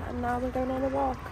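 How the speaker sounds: ordinary talk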